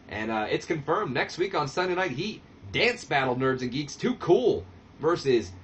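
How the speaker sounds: ordinary talk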